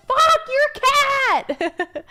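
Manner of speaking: high, loud, slow angry shout; retold furious yell. Streamer retells yelling about the cat, furious shout. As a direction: yell it out in full fury. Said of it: "I was like".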